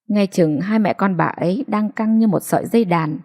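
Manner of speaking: plain reading voice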